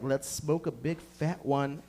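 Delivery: slow stoner voice. Retold or imitated. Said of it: "you're like".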